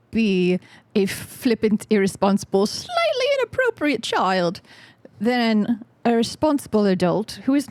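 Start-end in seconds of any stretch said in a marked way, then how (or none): 2.7-4.6 put-on high childish voice.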